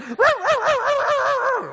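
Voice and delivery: high, rattling animal trill; eager animal-like noise. Retold or imitated. Man mimics men reacting to a woman, high animal-like noise. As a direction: let out a long high excited trill.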